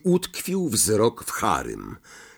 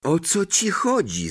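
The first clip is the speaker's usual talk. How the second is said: higher-pitched, annoyed question; rising irritation; 7 semitones higher than usual. Acted. Harry challenges Ron as anger takes hold, irritated and higher. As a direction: ask it with growing annoyance. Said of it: "że ogarnia go złość".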